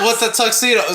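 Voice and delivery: ordinary talk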